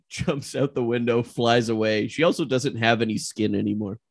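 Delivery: very low-pitched stage-direction voice; low, level narrator aside; scene narration. Acted. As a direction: narrate the action deadpan and deep.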